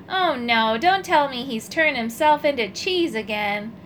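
ordinary delivery

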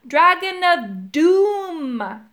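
slow, loud, high, stretched-out bellow; emphatic holler with a long falling finish. A narrator's reading. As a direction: boom it out word by word. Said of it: "bellowed Urk".

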